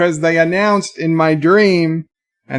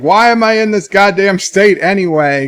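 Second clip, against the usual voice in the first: higher-pitched exasperated question; mildly mad outburst; retold anger; 5 semitones higher, 4 dB louder than usual. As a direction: demand it in retold irritation. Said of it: "getting even more mad".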